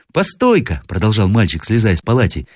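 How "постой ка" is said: put-on high child's cry; boy calling out. A narrator's reading. Grandson calls grandmother in the night, piping cry. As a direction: call out like an eager little boy.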